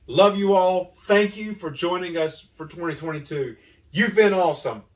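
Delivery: loud, high, beaming sign-off; excited shout. Real, not acted. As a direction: call it out big and happy. Cheerful sign-off to viewers.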